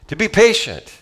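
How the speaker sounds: high and loud on patient; stressed punchline. Retold or imitated. Pastor teasing a young believer, high emphatic patient. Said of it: "And I always go".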